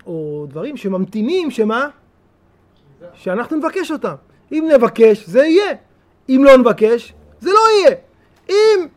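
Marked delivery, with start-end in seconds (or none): 4.5-8.9 louder and higher, driving the contrast; forceful preacher contrast; emphatic. Real, not acted. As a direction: hammer the if and then.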